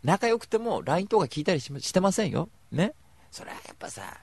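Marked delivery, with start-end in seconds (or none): 3.3-4.2 whisper. Real, not acted. Confiding aside.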